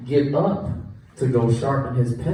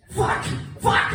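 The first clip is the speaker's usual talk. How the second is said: mock Sammy. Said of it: "Sammy walks in and he goes".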